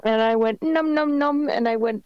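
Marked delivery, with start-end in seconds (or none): 0.6-1.5 high-pitched cartoon munching noise; imitated munching. Telling friends about eating a free bagel, imitated munching. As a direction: chomp playfully, up high.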